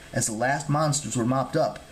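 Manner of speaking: ordinary delivery, nothing marked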